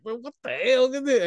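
high mock exasperation; acting out an irritated partner; exasperated comeback. Retold couple's quarrel at quiz.

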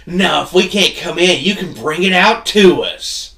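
high, loud imitation of an entitled customer; mock indignant demand. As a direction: holler it like an outraged customer.

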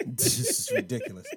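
high giggly laugh through words. Real, not acted. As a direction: laugh it off in disbelief. Laughing at the mic fuss.

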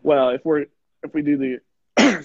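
talking through a choke; words caught in the throat; choking. Real, not acted. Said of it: "sorry, I'm choking on whatever I ate earlier".

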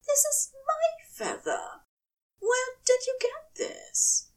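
high angel voice sinking to a whisper; hurt angel. Acted. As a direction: ask the question in a hushed, hurt whisper. Aziraphale questions Crowley.

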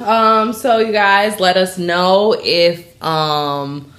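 normal talking voice

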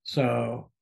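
throat catching mid-word; clearing a scratchy throat; frog in throat. Real, not acted. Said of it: "I got something in my throat".

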